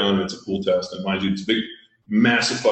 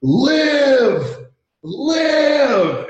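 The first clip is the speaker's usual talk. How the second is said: desperate scream, high and loud; 5 dB louder than usual. Retold or imitated. Corpsman pounding on a drowned kid's chest, screaming. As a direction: yell it like you're forcing life back into him.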